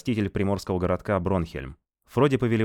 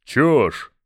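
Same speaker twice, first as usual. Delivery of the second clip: dismissive; 7 semitones higher, 9 dB louder than usual. Acted. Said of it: "отмахнулся Мангус".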